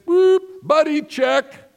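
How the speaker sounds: high, loud, drawn-out call to the pool; lifeguard call. Retold or imitated. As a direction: sing it out like a camp lifeguard. Lifeguard calling pool buddy check.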